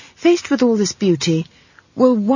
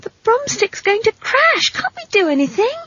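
put-on very high-pitched girl's plea; high, alarmed little girl; panicked child. A narrator's reading. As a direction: cry for help in a child's high voice. Child pleads as crash nears.

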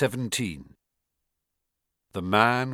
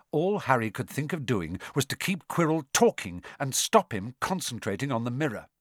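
ordinary delivery, nothing marked

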